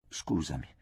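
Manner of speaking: "Scusami" mormorato piano, quasi senza voce; un mormorio colpevole a fatica. A narrator's reading. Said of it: "riuscii a mormorare". (chiede scusa a Micol, mormorando)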